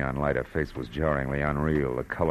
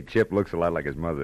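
ordinary talk, nothing marked

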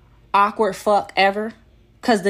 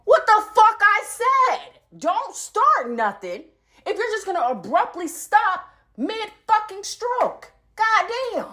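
exasperated outburst; 12 semitones higher than usual. Real, not acted. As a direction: let the outrage spill over. Reliving her outrage.

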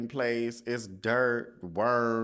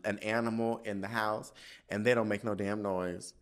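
normal talking voice

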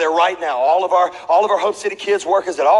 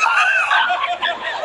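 very high shrieking laughter; crazy wailing laugh. Retold or imitated. Kid crying after the fight, shrieking laugh. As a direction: squeal it out wildly.

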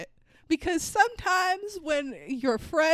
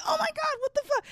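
shocked; 14 semitones higher, faster than usual. Retold or imitated. Shocked reaction retold.

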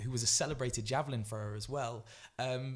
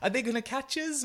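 very high, loud innocent mimicry; mock-naive high imitation; Emil Zatopek; 13 semitones higher, 7 dB louder than usual. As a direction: ask like a clueless novice in a squeaky put-on voice. Emil naively questions the rival runner.

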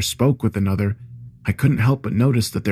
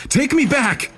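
very high, frantic cry; high-pitched desperate anger; furious demand. A narrator's reading. Enraged narrator slamming the stranger.

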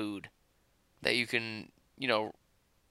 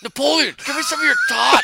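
shouting; 12 semitones higher, 8 dB louder than usual. Retold or imitated. Mocking a movie kid.

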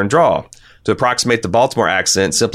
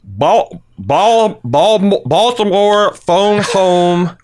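much higher, louder hammed-up Baltimore vowels; Baltimore accent; 11 semitones higher, 3 dB louder, much slower than usual. Shown as a demonstration. Trying the listener's accent tip: high mock Baltimore accent. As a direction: stretch the O's in "phone home" high and long. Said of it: "you need to emphasize long vowel sounds".

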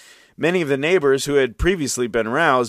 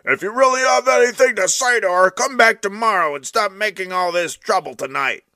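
higher, louder cross voice; a fed-up neighbor; scolding neighbor; 8 semitones higher, 3 dB louder than usual. A narrator's reading. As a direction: tell him off from the window. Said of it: "started to bay at him".

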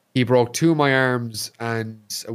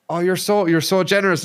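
higher, bright grateful gush; gushing thanks; 8 semitones higher, 3 dB louder than usual. Retold or imitated. Thanking the generous scav, gushing. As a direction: overflow with thanks. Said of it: "I was like".

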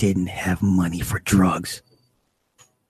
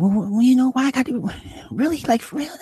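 very high squeal imitating a woman; mimicked protest; faster than usual. Woman objecting to the dealer's terms, high mimicked protest. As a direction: protest in a mock falsetto. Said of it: "her ranting and raving".

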